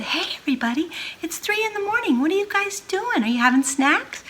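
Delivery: high, hushed mom. Acted. Mom at three in the morning, high and hushed. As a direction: ask in a high half-whisper.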